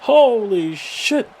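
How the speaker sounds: high, drawn-out shock; shocked amazement. Real, not acted. Commentator seeing an attack before the bell, shocked. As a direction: stretch each word high in real disbelief.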